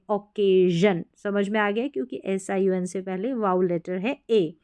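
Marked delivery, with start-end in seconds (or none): 0.1-1.0 ओकेजन with stretched ay and voiced zhun. Shown as a demonstration. Teacher correcting the sh reading, ओकेजन with zh. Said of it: "ओकेशन नहीं पढ़ेंगे".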